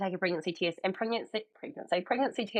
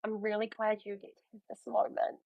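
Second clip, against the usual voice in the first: touched sincerity; 4 semitones higher, 5 dB quieter than usual. Retold or imitated. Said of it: "I was like".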